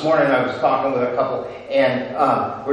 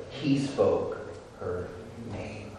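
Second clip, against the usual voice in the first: dropping to a soft hush; fading hush; 7 semitones lower, 12 dB quieter, slower than usual. Acted. Preacher hushing the congregation on her name, fading soft. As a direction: let the words fade to almost nothing.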